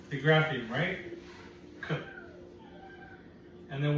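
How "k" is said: ordinary delivery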